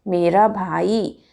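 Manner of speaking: मेरा भाई slow and drawn out; correct model phrase. Shown as a demonstration. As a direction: stretch each word of मेरा भाई. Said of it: "क्या बोलते हैं हम". (मेरा भाई धीरे-धीरे)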